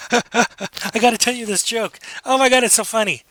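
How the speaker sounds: high, mock-giggly excitement; giggly friend. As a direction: act bursting to share a joke. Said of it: "they're laughing and giggling".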